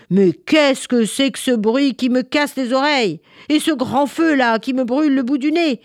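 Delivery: loud, high indignant complaint; angry winter. Acted. Winter fully awake, complaining loudly about the noise. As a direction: rant at the noise. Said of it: "vraiment en colère".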